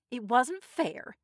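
slow, high, loud complaint; protesting. A narrator's reading. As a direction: drag out the protest. Tessie keeps protesting the draw.

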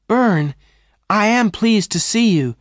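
high, loud glad greeting; delighted cry. A narrator's reading. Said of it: "Jane cried".

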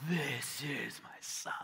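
slow, breath-heavy whisper of God's voice; hushed, drawn-out divine whisper; awed whisper. Acted. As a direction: whisper it slowly, as if from the heavens. Imagines heavens breaking open, hushed awe.

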